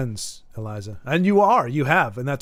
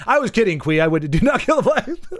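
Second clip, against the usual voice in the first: laughing, higher-pitched protest; 5 semitones higher, 3 dB louder than usual. Real, not acted. Streamer backtracking on a joke to viewers, laughing hard. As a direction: protest through real laughter. Laughing over a joking suggestion.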